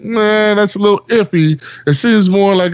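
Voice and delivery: high-pitched doubtful quibble; retold skepticism. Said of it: "I'm like".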